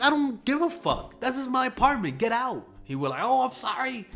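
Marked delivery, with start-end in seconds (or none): 0.0-2.7 high, indignant mock yell; retold angry yell. Telling the landlord to leave, high indignant yell. 3.2-4.1 mock landlord apology.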